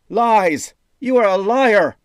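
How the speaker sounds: high, loud furious accusation; shouting. A narrator's reading. Jaden shouts down the wizard's insults, furious. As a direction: scream it at the liar. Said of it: "Jaden shouted".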